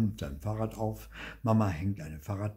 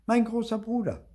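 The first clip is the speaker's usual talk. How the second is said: put-on higher child voice, earnest; little boy voice; 6 dB louder than usual. A narrator's reading. Theo telling his parents about his brother, high child voice. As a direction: play the small boy Theo.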